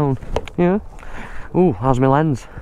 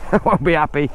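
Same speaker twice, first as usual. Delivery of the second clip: ordinary talk, nothing marked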